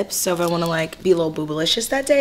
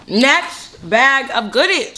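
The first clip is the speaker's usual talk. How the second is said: talking while chewing; words round a bite of food; mouth full; 5 semitones higher, slower than usual. Real, not acted. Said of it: "Sorry that I'm chewing".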